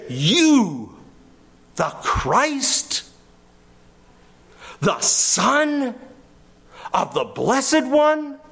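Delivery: "You" punched, then high, incredulous phrases broken by long pauses; insulting emphasis. Acted. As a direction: throw "You" as an insult, then spell out the title piece by piece. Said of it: "throwing a tremendous insult".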